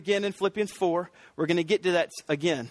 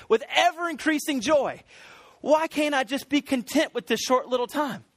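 high, fervent preacher's delivery; impassioned preaching; 7 semitones higher than usual. Acted. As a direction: preach it with urgent, high-pitched conviction. Preaches with rising fervour.

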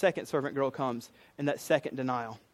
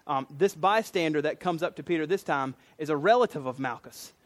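ordinary talk

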